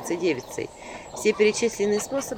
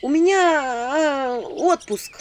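a touch of drowsy reluctance; 8 semitones higher, 4 dB louder, much slower than usual. A narrator's reading. Witch replies to visitor.

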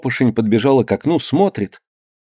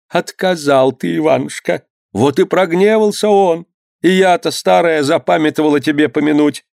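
высокий старушечий голос, сокрушённо; сокрушайся по-старушечьи; голос старой матери; 6 semitones higher than usual. A narrator's reading. (мать корит себя за забывчивость, высоко)

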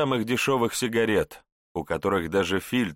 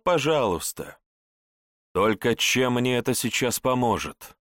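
plain reading voice

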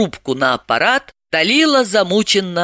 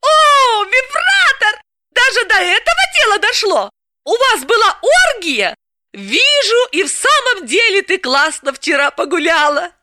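much higher, louder, thrilled; delighted squeal; 3 dB louder than usual. Acted. Galina thrilled by Dalila's slip, high excited voice. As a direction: squeal with glee. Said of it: "от восторга подпрыгнула".

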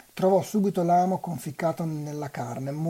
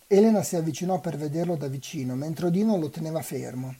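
plain reading voice

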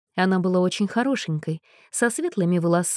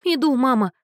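high, meek squeak; 6 semitones higher, 4 dB louder than usual. A narrator's reading. Scolded daughter squeaks meekly to her mother. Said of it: "пропищала она".

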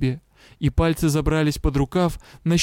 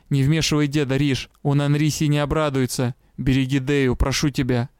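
usual speaking voice

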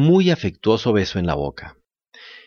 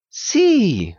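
high cat echo; 9 semitones higher than usual. A narrator's reading. Cat echoes.

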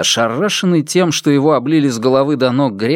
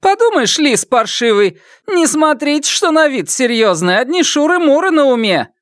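mocking scorn; 14 semitones higher than usual. A narrator's reading. Wild Donkey mocks on.

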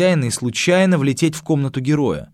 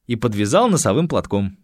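ordinary delivery, nothing marked

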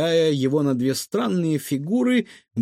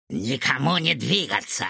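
malicious order. A narrator's reading. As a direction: order them nastily, without shouting. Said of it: "злобно приказал доктор Финдершлоц".

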